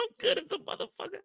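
high, breaking laugh-cry through the words; laughing till crying. Real, not acted. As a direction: say it while cracking up to tears. Repeats line, laughing till crying.

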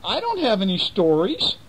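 high whiny weasel voice. Acted. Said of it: "said the weasel".

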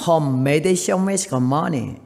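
very slow, deliberate broken English; 느릿느릿한 콩글리시; Korean-accented English. Retold or imitated. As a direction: spell out each English word slowly. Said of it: "한마디만 할 줄 아는 영어를 했어요".

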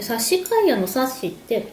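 ordinary talk, nothing marked

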